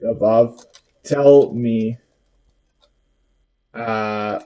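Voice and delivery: slow, careful dictation to a voice assistant; dictating. Shown as a demonstration. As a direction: spell it out word by word for the machine. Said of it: "I'm gonna say".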